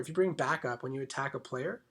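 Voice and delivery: normal talking voice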